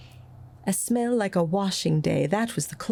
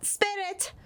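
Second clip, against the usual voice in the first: high, soft anxious cry; urgent plea; 5 dB quieter than usual. A narrator's reading. Scrooge begging the ghost, high and hushed. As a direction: call out to the ghost in alarm. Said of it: "said Scrooge".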